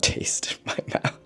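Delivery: half-whispered confession with a laugh; whispering a guilty opinion. Real, not acted. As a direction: let it slip out in a hushed, amused whisper. Confiding about a disappointing ending.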